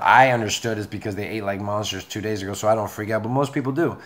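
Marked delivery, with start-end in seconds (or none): none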